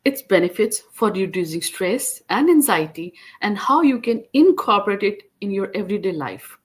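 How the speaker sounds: scripted show opener, lifted and emphatic; podcast host introduction. Acted. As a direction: welcome listeners and announce the guest. Presents the episode introduction to listeners.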